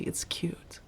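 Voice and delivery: barely breathed, teasing; teasing whisper. Acted. Vampire teasing her blushing roommate, whispered. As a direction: tease her softly under your breath.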